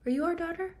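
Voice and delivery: high sneering question to his daughter; mocking father. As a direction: toss it off, mocking her. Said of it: "what does he say to her".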